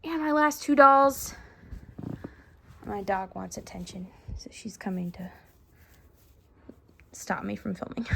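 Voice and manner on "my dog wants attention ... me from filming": whisper aside. Real, not acted. Aside about dog.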